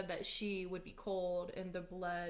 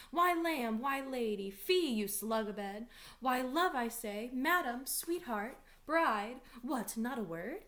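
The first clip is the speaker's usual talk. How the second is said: raised, high sing-out to a sleeper; calling to rouse someone; 4 semitones higher, 5 dB louder, slower than usual. Acted. Nurse to sleeping Juliet at the bed curtains, calling out to wake her. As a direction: call out insistently to wake her.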